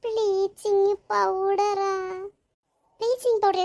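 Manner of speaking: slow, drawn-out incredulous echo; shocked disbelief. Acted. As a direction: repeat it slowly in horror. Shocked echo of bleaching powder. (அதிர்ச்சியுடன் இழுத்த கேள்வி)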